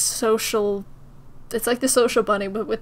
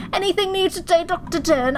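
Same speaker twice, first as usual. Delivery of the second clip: higher-pitched put-on British voice; British accent; 5 semitones higher than usual. Nurse asking Doctor Dern for news, British accent. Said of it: "why everyone's British and I'm not".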